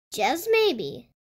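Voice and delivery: plain reading voice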